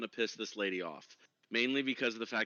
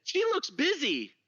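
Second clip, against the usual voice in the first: high dwarf voice; 6 dB louder, slower than usual. Acted. Dwarf character remark.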